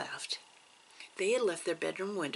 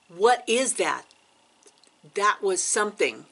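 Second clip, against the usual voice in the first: imitated urgent questioning, raised voice; retold alarmed insistence; 3 semitones higher, 8 dB louder than usual. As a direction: voice a sister insisting something is there. Sister insists she heard something.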